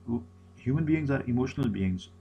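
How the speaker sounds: ordinary delivery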